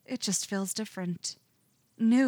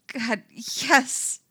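near sob; 5 semitones higher, 5 dB louder than usual. A narrator's reading. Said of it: "sounds a little bit like a sob".

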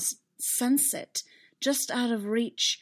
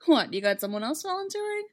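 higher-pitched reply question; surprised; 8 semitones higher, 4 dB louder than usual. A narrator's reading. Visitor answers the prisoner's doubt, surprised. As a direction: toss it back with surprise in a raised pitch. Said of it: "sounding surprised".

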